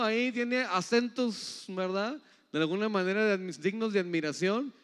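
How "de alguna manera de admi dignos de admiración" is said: ordinary talk, nothing marked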